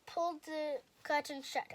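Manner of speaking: ordinary delivery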